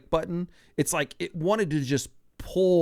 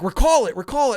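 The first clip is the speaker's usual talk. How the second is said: urgent command; 9 semitones higher, 7 dB louder than usual. Retold or imitated. Dan urging him.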